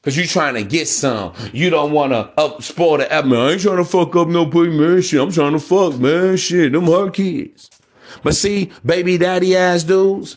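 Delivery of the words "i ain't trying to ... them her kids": mock careless dude. Imitating next man.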